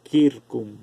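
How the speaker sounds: circum as "kirkum", set apart on its own; classical Latin hard C. Shown as a demonstration. Teacher repeating the Latin for students, circum with hard K. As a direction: repeat circum clearly with a K at the start. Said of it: "La C suena como K en latín".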